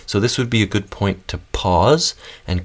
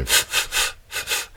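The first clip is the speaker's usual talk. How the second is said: short breathy f pulses of air; whispered fuff pulses, one per syllable; pulsed f sounds; 6 dB quieter than usual. Shown as a demonstration. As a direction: push air through teeth and lip in little bursts. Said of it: "pulsing the air through that constriction".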